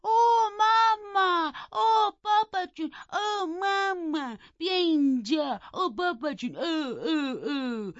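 high, drawn-out mock bawling for parents; wailing cries. A narrator's reading. Frightened Linton children call mama and papa, mock wailing. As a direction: wail each call long and high, falling away. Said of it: "بعد صداشون بلند شد".

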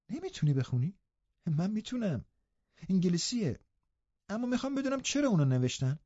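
put-on high, soft girlish astonishment; surprised. A narrator's reading. Girl amazed that her cousin cannot read, surprised. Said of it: "کاترین با تعجب گفت".

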